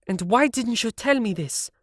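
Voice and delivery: rounding on the producer in his chair; angry young woman; angry Siv. A narrator's reading. Siv demands an answer.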